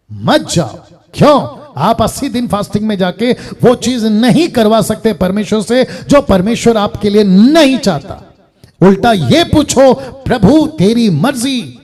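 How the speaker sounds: loud, emphatic preacher's warning; full-force preaching. Real, not acted. Preacher warning against fasting for titles, forceful preaching. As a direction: drive it home loudly, punching the negatives.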